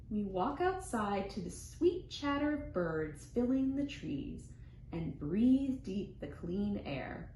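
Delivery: ordinary delivery, nothing marked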